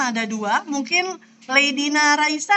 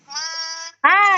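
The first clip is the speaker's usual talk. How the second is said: normal talking voice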